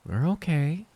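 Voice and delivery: high bright reassuring reply; high gentle mother. Acted. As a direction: answer high and light, covering worry. Clara answers brightly.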